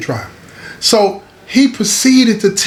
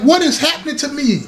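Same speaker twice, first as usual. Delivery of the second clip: puzzled cry; 7 semitones higher than usual. Retold or imitated. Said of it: "He's like".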